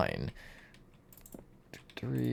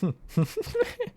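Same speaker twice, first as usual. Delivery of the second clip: high giggle. Real, not acted. Laughing at uncanny grinning image.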